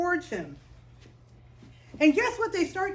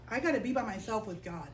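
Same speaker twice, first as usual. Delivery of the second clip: softer, lower inner voice; quiet thought; 6 semitones lower, 7 dB quieter, faster than usual. Retold or imitated. Jacob thinking alone by the brook, softly. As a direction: think it to yourself, hushed. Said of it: "and he thought".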